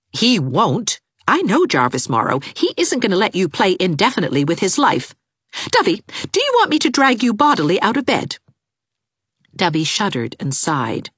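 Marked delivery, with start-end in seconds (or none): 0.1-8.4 firm, higher order; firm young woman; commanding Anne. A narrator's reading. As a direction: order her out of bed.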